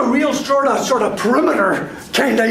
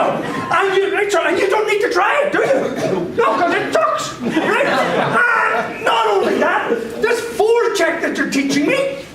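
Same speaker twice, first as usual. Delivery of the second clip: mock whiny player; 7 semitones higher than usual. Mocking players who refuse to try.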